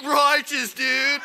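loud, high stoner-surfer reply; surfer dude shout. Retold or imitated. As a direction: yell it like a hammed-up surfer. Answering the surfer tech in kind.

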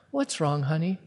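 softly imitated, soothing question; low and slow. Wife soothing her irritated husband, low and slow. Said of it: "Just low and slow".

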